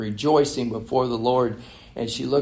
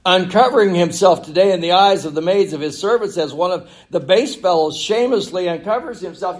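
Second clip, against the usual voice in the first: higher, projected mock admiration; sarcastic; 6 semitones higher, 6 dB louder than usual. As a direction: ring out the false praise with scorn. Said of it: "sarcasm in her voice".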